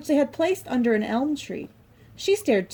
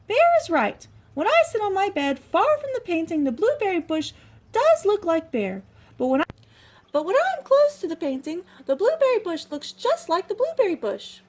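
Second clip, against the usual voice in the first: very high mouse thinking aloud; high little mouse reasons; squeaky mouse voice; 9 semitones higher, 4 dB louder than usual. Acted. Little Mouse musing alone.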